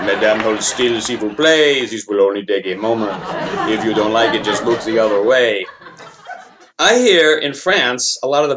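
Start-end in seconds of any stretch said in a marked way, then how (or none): 0.0-5.6 low French doctor voice; smooth French accent, lower pitch. Acted. French doctor giving vaccine shot.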